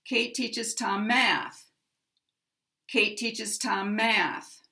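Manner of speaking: math strongest, falling at the end; stress on math. Shown as a demonstration. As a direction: main stress on the last word math. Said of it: "math is the primary stress".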